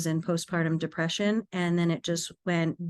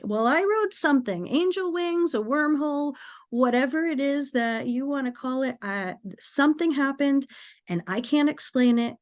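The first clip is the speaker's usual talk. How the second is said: higher-pitched acted-out bewilderment; flustered reply; 6 semitones higher than usual. Daughter explaining the inexplicable to mother, flustered. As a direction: insist on it, flustered and at a loss.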